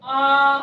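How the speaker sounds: आ held long and loud, twice the length; drawn-out आ for the class; long vowel demo. Shown as a demonstration. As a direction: stretch the आ out to double length. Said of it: "आ कहने में दोगुना समय लगता है".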